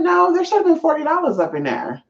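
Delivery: telling the room the box held forty dollars, voiced high and indignant; high indignant protest. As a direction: demand the missing money in a high, wronged voice. Retelling own protest.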